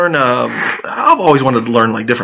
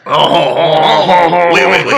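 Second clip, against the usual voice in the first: hammed-up snooty French "oui"; stuck-up French accent; 2 semitones higher, much slower than usual. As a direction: play a pretentious French speaker. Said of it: "sounding like a stuck-up asshole".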